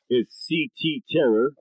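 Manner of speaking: ordinary talk, nothing marked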